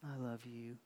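tender murmur. Retold or imitated. A hint of a dating couple, soft and tender. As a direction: murmur it like a sweetheart. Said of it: "look at each other, and".

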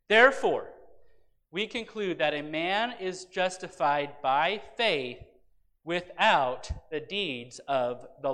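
slow, emphatic reading with pauses between phrases; measured pulpit Bible reading; scripture reading. Acted. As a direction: read the verse out deliberately, lifting the questions. Reads scripture aloud.

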